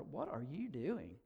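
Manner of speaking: hushed, slow, mildly uneasy question; nervous question. A narrator's reading. Cave dweller questioning the stranger, mildly nervous. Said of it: "one cried nervously".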